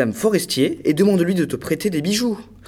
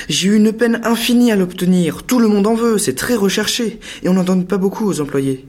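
eager pleading; 6 semitones higher than usual. Acted. Husband pleads eagerly with wife.

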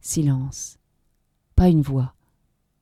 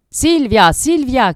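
high, loud desperate call; frantic shout of her name; 8 semitones higher, 7 dB louder, much slower than usual. A narrator's reading. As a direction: yell for her across the street. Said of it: "cria-t-il".

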